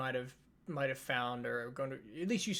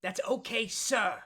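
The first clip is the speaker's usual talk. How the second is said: loud, higher drillmaster's correction; sharp, raised instructor voice; barked correction; 8 semitones higher, 8 dB louder than usual. Acted. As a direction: demand respect like a drill instructor. Instructor corrects the recruit's reply.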